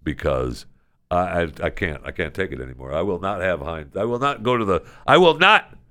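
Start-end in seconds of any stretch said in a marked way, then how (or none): 5.0-5.8 hammed-up indignant declaration, higher and louder; mock outrage. Host vowing to protect ketchup shelves, in hammed-up outrage, higher and louder. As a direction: proclaim it like a defiant leader.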